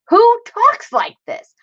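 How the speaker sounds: high, drawn-out disbelief; slow, high-pitched incredulous outburst. Real, not acted. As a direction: squeal it in baffled amazement at the wording. Reacting to old-fashioned yearbook wording.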